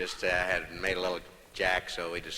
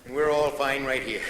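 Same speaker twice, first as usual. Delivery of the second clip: high Boston-style presidential drawl; mock JFK speech voice; Kennedy impression; 8 semitones higher, 5 dB louder than usual. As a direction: play John Kennedy talking frontiers, pitched up. Kennedy impression, new frontiers.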